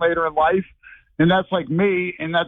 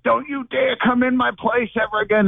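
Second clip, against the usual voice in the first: mild mock yell, pitched up; retold yelling; 8 semitones higher than usual. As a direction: replay the yelling at the neighbor, lightly. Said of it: "I was yelling and screaming".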